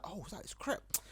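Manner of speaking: quiet mock gasp of recognition; inner-voice exclamation. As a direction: say it softly, as if only thinking it. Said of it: "I don't say it out loud in my head".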